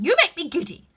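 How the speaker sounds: shrill, loud, exasperated scream. A narrator's reading. The Queen screaming at the gardeners who keep bowing. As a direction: shriek it high and loud. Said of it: "screamed the Queen".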